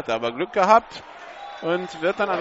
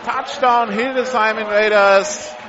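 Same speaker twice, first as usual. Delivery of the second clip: touchdown call; 3 semitones higher, 4 dB louder than usual. Real, not acted. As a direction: belt out the touchdown pass. Touchdown pass called live.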